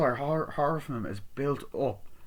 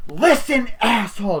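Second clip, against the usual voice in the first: loud, high-pitched mock threat into the phone; hammed-up phone shout; angry yell; 9 semitones higher, 9 dB louder than usual. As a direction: bark it at the caller, way up high. Caller yelling threat down phone.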